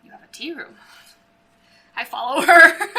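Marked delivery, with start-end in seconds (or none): 2.0-3.0 giggling through the words; laughing. Real, not acted. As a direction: laugh while you say it. Both laughing at the tea room.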